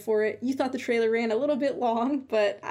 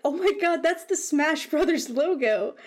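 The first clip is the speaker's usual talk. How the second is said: amazed recognition; 5 semitones higher, 3 dB louder than usual. Retold or imitated. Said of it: "I had to gasp really loud".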